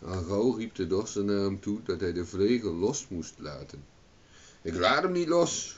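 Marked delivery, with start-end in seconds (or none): 4.6-5.7 high, loud refusal; shouting. A narrator's reading. Owen refuses to release the flail, shouting. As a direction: shout back in a raised pitch. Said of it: "schreeuwde Owen".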